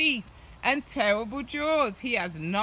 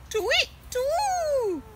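high owl hoot; much higher hooting bird call. Acted.

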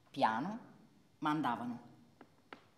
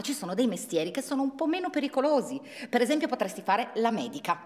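hurried coaxing; 7 semitones higher, 6 dB louder, faster than usual. Acted. Parent coaxing daughter to safer jobs.

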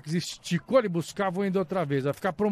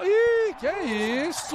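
amazed exclamation; 12 semitones higher than usual. Real, not acted. Dazzling dribble.

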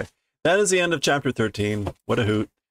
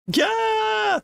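very high shrieked yeah; piercing childish scream; girl's scream. Acted. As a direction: shriek in panic. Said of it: "Miura is screaming".

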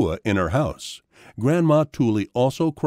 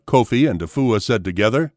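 ordinary delivery

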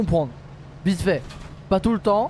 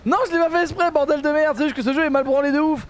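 high-pitched, heated rant; exasperated protest; 9 semitones higher than usual. Real, not acted. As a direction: protest your innocence, fed up with the game. Angry protest at the game.

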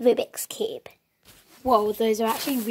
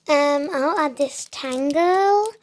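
ordinary talk

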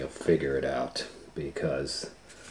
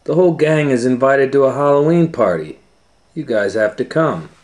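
higher, louder excited Fred; Fred's invitation; 7 semitones higher, 9 dB louder than usual. Acted. Fred invites the gang to the party, excited and loud. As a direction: call the gang eagerly. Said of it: "came in with Velma and Daphne".

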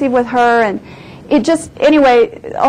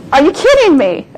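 retold disbelief; 4 semitones higher than usual. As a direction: fire it back in mock disbelief. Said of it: "and I got mad".

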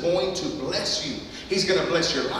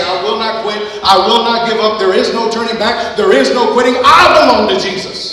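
louder with every vow; loud preaching declaration; 3 semitones higher, 9 dB louder than usual. Acted. Pastor shows congregation what to declare, loud preaching. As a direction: proclaim it like a battle cry.

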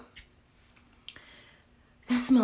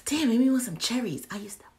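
normal talking voice

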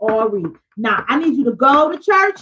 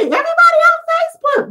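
much higher, quick, put-on voice for what people say; mocking quote; 3 dB louder, faster than usual. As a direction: squeal it like a teasing crowd. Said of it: "They say".

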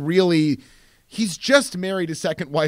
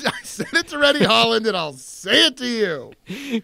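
louder, hammed-up indignation; mock irate rant; 3 semitones higher, 5 dB louder than usual. Director telling off Rennie Holland, mock irate. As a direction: play an offended director throwing someone out.

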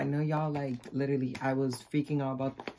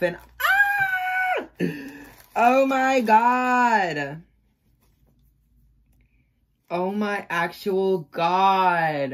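excited scream; 4 semitones higher, 8 dB louder, much slower than usual. Real, not acted. Opening gift package, screaming.

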